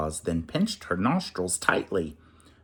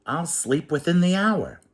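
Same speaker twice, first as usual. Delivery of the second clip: relieved; 8 semitones higher than usual. Acted. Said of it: "he cried".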